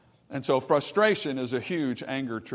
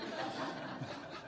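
a quiet unvoiced chuckle; soft breathy laugh; 14 dB quieter than usual. Real, not acted. Pastor chuckling softly at his own joke, breathy laugh. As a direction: let out a short breathy laugh.

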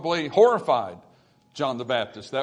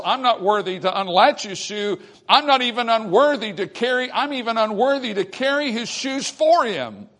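preacher's rising protest of unworthiness, higher and louder; impassioned humility; 6 semitones higher, 5 dB louder than usual. Acted. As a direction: build higher and louder as John insisting he's unworthy. Preacher voices John's humble protest.

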